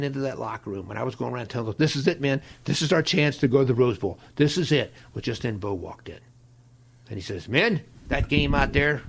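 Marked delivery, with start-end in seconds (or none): 1.8-4.9 retold locker-room rally, loud and higher; rally the team with punchy urgency; fired-up rallying cry. 7.5-9.0 loud, slow address to the men; retold coach's speech.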